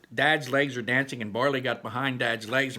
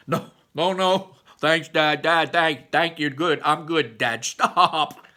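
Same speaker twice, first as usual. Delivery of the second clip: loud, higher, playful protests; laughing protest; 6 semitones higher, 10 dB louder, slower than usual. A narrator's reading. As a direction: brush off a goofy dad, laughing. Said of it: "trying to resist".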